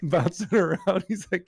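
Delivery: high laughing words. Real, not acted. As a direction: giggle through the words. Laughing while retelling.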